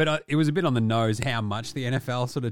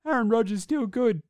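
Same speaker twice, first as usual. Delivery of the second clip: high, put-on simple inner voice; lizard-brain voice; 10 semitones higher than usual. Lizard part of the brain talking to a fan, high mock voice. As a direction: pitch it right up as a mock primitive thought. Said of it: "lizard part of your brain".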